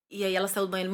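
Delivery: ordinary delivery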